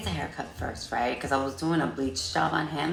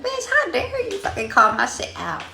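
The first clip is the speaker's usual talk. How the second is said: high, louder mock outrage; sassy outrage; 13 semitones higher, 5 dB louder than usual. Hairdresser snapping at the unhappy client, high mock outrage. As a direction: fire it back with diva indignation. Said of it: "I'm like".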